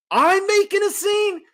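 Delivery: high, loud mock disbelief, thrown to the crowd; mock incredulous. To the checkout crowd, retelling, high mock-incredulous call. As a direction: feign shock that you're the problem. Turns to checkout line mockingly.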